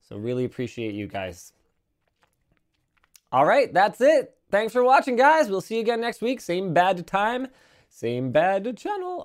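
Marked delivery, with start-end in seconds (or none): 3.3-9.2 high, upbeat outro voice; cheerful sign-off to viewers; show sign-off. Acted.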